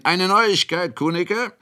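high, excited announcement; calling out. A narrator's reading. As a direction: call the news into the room. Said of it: "rief hinein".

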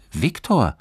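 high, loud, puzzled single word; astonished. A narrator's reading. Johannes is caught off guard by the question, astonished. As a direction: say the name in surprise. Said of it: "sagte Johannes verwundert".

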